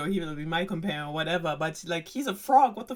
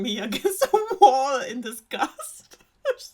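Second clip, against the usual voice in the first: high, loud laughing; 7 semitones higher, 8 dB louder than usual. Real, not acted. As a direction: laugh through the words. Laughing mid quote.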